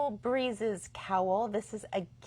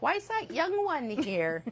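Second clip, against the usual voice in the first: higher-pitched mock old-timers' question; old people, imitated; 6 semitones higher than usual. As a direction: imitate nosy old folks asking, pitched up. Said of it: "all the old people are like".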